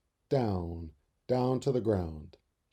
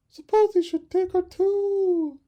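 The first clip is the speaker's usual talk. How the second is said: very high, slow anxious voice; worried mother bird; 9 dB louder, slower than usual. Acted. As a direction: fear for the other chick. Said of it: "said the mother bird".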